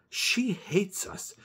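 slow frightened whisper; scared whisper. Acted. Boy whispering his fear of the neighbour. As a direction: hush it like a secret fear.

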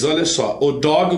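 normal talking voice

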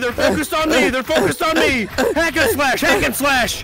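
high, loud panicked calls; excited high-pitched gamer yelling; frantic battle shouting. Real, not acted. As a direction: yell orders in the thick of a fight. Frantic calls during game fight.

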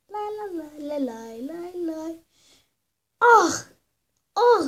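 ordinary talk, nothing marked

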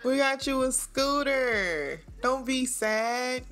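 slow, high sing-song coaxing; cooing to a child. Pretend. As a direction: coax a little one with a present. Cooing to child Sim with gift.

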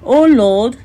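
ordinary talk, nothing marked

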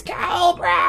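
high, whiny villain screech; whiny Cobra scream. Retold or imitated. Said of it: "scream in his, like, whiny voice".

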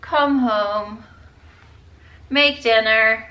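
normal talking voice

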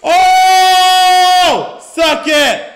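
long, loud, high victory yell; gloating shout. Real, not acted. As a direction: roar in triumph at a kill. Gloating over a kill.

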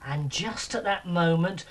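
usual speaking voice

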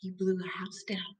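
very soft, breath-only story line; hushed storytelling. Acted. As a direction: drop to a near-whisper. Hushed end of wolf's blowing.

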